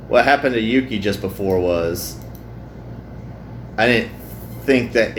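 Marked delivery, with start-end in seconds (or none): none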